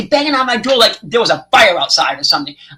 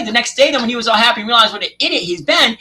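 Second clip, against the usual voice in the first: normal talking voice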